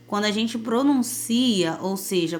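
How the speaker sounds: ordinary talk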